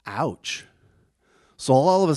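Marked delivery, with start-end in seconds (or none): none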